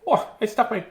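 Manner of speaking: high squirrel confessing he hoarded nuts. Acted.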